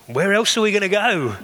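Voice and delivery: Peter, surprised anyone would ask; incredulous. Retold or imitated. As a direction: throw the question back as if the answer is obvious. Peter looking around, answering Jesus.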